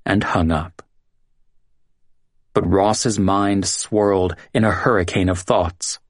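ordinary delivery

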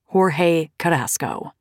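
plain reading voice

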